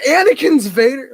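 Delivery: high, loud shocked exclaim; shocked kid. Retold or imitated. Kid realizing the twist, high and loud. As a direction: blurt the discovery.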